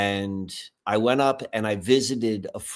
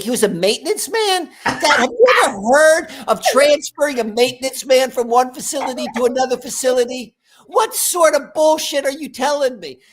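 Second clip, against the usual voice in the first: much higher and louder, incredulous; outraged disbelief; 13 semitones higher, 7 dB louder than usual. Retold or imitated. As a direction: call out the nonsense, indignant. Son outraged at dad's story.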